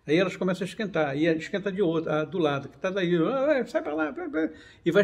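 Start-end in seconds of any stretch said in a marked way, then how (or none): 3.1-4.5 high, squeaky cartoon molecule shoving a neighbour; a tiny particle grumbling "get away"; voiced molecule. (molécula empurrando a vizinha, voz aguda de desenho)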